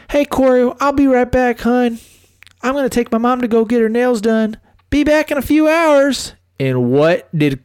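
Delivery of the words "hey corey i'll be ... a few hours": much higher, sweet girlfriend impression; mock girlfriend voice. Mimicking wife telling Corey she's going out, high and sweet. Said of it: "Angie says".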